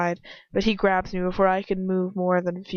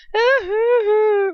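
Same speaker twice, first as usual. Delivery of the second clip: squealing high laugh; burst of high laughter; 8 dB louder than usual. Real, not acted. Reader cracks up at her own recap. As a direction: laugh out loud, high and breathless.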